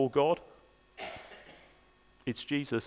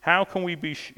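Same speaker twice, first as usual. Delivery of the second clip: normal talking voice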